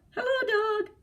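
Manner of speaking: chirpy little duck saying hello; cheerful duck voice. Acted. Duck greets dog.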